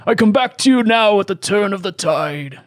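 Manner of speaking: high, grave wizard proclamation; Gandalf voice. Acted. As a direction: intone it like an old wizard. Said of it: "I just wanted to say a Gandalf thing".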